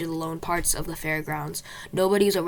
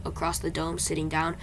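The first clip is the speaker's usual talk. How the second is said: usual speaking voice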